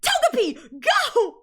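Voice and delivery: high, loud battle cry of a trainer; triumphant call. Retold or imitated. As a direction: fling the Pokemon into battle with a shout. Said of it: "She goes".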